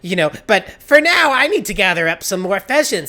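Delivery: high, loud, gushing with anxious eagerness; panicked villager. Acted. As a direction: babble desperate promises to placate someone.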